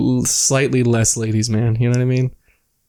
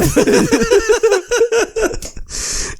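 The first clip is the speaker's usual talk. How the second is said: high squealing laugh. Real, not acted. Laughs hard.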